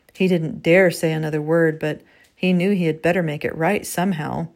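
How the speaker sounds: usual speaking voice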